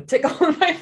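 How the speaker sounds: laughing mid-sentence; breaking into a surprised laugh. Real, not acted. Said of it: "I'm sorry".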